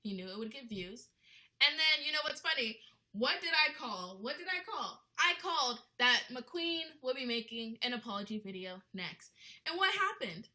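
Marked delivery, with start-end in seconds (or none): 1.7-7.9 excited gloating. Real, not acted. Gloating about a prediction. 9.7-10.5 excited rhetorical question. Real, not acted.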